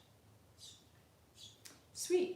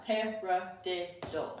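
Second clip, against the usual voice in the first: slow spoken rhythm; 2 semitones lower, 3 dB quieter, much slower than usual. Shown as a demonstration. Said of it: "as we say in our heads, our little scat".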